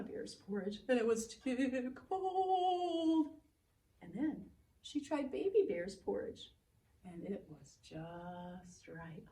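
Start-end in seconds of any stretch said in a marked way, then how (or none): 1.3-3.3 slow drawn-out cold. A narrator's reading. Porridge too cold, drawn out. 7.0-9.2 soft satisfied. A narrator's reading. Narrator softly says the porridge was just right.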